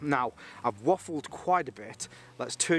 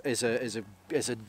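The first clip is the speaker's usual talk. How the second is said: usual speaking voice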